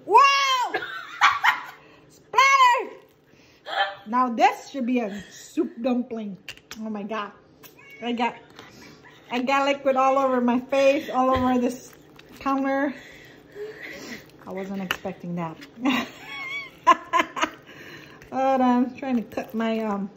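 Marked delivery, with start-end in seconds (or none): 0.1-3.0 high laughing yelp; surprised laughing shout. Real, not acted. Dumpling bursts on her face. 15.6-18.0 squealing giggle; high shrieking laugh. Real, not acted. After liquid sprayed her.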